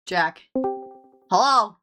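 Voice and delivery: ordinary talk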